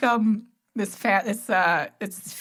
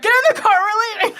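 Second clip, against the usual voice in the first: high, loud barked order; angry command; 11 semitones higher, 6 dB louder, faster than usual. Retold or imitated. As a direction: order everyone into the car. Quoting angry drunk dad.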